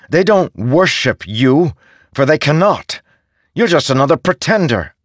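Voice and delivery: rising, forceful accusation; defiant challenge. Acted. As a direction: stand up to a false god. Kaladin confronts Ishar.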